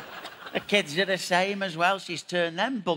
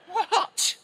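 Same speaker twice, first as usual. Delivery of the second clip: incredulous. Acted. Disbelief at old photo.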